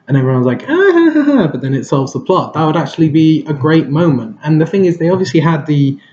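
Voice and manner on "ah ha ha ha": high-pitched imitated group laugh; mock laughter. Imitating the team laughing at Aquaman, mock laughter. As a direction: fake "ah ha ha", pitched way up.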